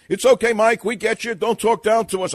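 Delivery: listeners answering back. Acted. Audience reassures the host, high and loud mock protest.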